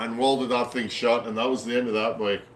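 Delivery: ordinary delivery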